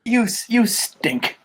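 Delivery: slowed-down voiced taunt; slow repeat. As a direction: drag it out slowly as a playful jab. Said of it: "the hell is that about".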